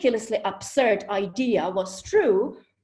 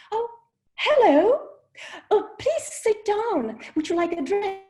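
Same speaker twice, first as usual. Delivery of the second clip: much higher, louder, gushing welcome; eager gentleman; 11 semitones higher, 5 dB louder than usual. Retold or imitated. Englishman welcomes the woman to his table, gushing. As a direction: greet her like a delighted host.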